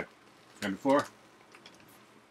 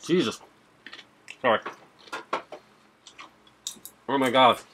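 through hand over mouth; reacting to spicy food; mouth full, chewing; 5 semitones higher, 4 dB louder than usual. Real, not acted. Seen 1: Chip eaten just before; still eating, hand covering mouth while reacting.

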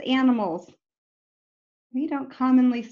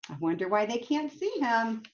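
ordinary delivery, nothing marked

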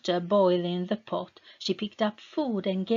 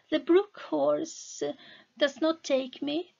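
timid attempt; 8 semitones higher than usual. Meta, relieved in the water, tries bravely but unsure. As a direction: try to be brave, still unsure. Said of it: "she said, trying".

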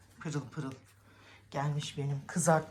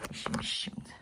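whispered aside; 9 dB quieter than usual. Real, not acted. Muttered aside.

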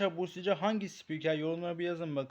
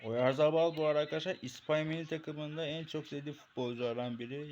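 ordinary talk, nothing marked

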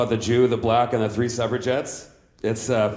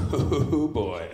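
breathy wheezing laugh fading to silence; giggling fit that trails into air; 2 semitones higher, 6 dB quieter than usual. Real, not acted. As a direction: crack up at the memory. Laughing at own memory.

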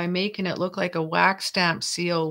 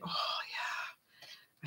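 whispered, drawn-out delight; much softer, savour it in a whisper; whispered relish; 15 dB quieter than usual. Real, not acted. Potion maker to herself as the mixture works, whispered relish. Pleased aside while making potions.

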